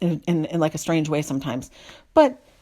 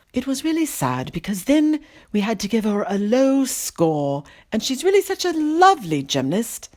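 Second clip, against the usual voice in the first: put-on Downton Abbey accent; 4 semitones higher than usual. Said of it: "Downton Abbey accent".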